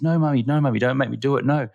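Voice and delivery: mock-frightened seven-year-old pleading; pleading child. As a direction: beg Mummy not to make you do it. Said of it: "'Cause I was so nervous and terrified".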